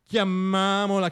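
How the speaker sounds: ordinary talk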